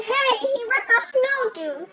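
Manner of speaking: usual speaking voice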